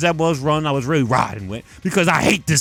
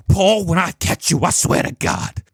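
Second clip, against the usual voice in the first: menacing threat. Pretend. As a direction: mutter it like a vow of revenge. Mock threat to Paul.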